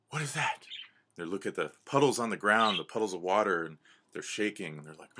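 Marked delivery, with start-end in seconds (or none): 0.1-0.7 alarmed question. Acted. Animals alarmed by shaking ground.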